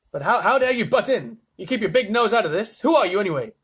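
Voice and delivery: affronted astronaut's rebuke; indignant. A narrator's reading. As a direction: tell off the intruder. Shanks tells off intruding voice.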